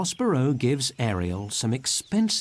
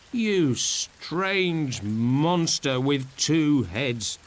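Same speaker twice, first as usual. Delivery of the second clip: put-on slow, wondering drunk voice; Stefano; 3 semitones higher, 3 dB louder, much slower than usual. A narrator's reading. Stefano marvelling at the two-headed monster, slowly. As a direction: savour each word, tipsy. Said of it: "says Stefano".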